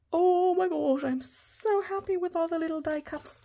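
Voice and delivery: high-pitched happy coo; gushing delight. Real, not acted. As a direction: coo over them with joy. Gushing over die cuts.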